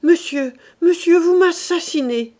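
high, loud alarm; anguished outcry. A narrator's reading. Mother to husband during the knife threat, anguished outcry.

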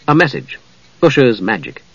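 ordinary delivery, nothing marked

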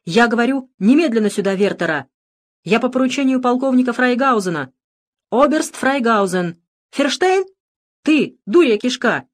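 loud furious commands; angry shouting. A narrator's reading. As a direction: shout him down in a rage. Священник кричит на патрульного. (гневный крик)

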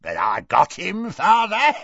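gleeful boast rising into a cackle; cackling. A narrator's reading. Morfin boasts to his father, cackling. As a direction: jump high and loud on the last word. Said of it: "cackled Morfin".

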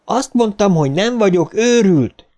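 put-on a boy shouting he is not crazy; shouted. A narrator's reading. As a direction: yell it back at him. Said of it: "ordította Eddie".

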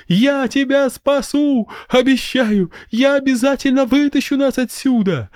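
high kid in a mock rescue; playacting child. A narrator's reading. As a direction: overplay heroic rescue like kids do. Children playing dying scene.

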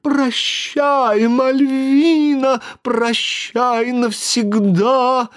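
high, slow, squeaky whimper; wailing boo hoo crying. Acted. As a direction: wail it like a sobbing little Pierrot. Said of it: "плаксивым голосом пропищал человечек".

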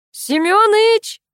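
very high, drawn-out holler of the name; full-throated call. A narrator's reading. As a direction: holler for a neighbour out of sight. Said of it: "Лена повторила попытку". (протяжно звать во всё горло)

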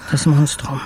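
ordinary delivery

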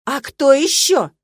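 loud, drawn-out indignant accusation; outraged. A narrator's reading. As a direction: hurl it back, scandalized. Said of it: "возмутилась старушка".

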